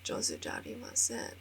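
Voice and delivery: soft, humble whispered brush-off; quiet modest no, josu jarimasen; modest hushed denial. Retold or imitated. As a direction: wave off the compliment under your breath. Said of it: "and then I'll be like".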